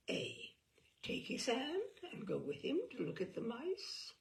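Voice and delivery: ordinary delivery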